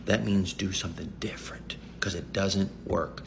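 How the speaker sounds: whispering. Real, not acted. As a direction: lean in and whisper it. Urgent advice, half voice.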